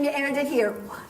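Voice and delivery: ordinary talk, nothing marked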